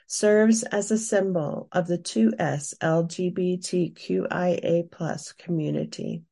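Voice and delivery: usual speaking voice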